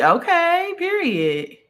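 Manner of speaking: sudden high rising okay; surprised okay. Real, not acted. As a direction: jump up in pitch, delighted. Reacting to a comment live.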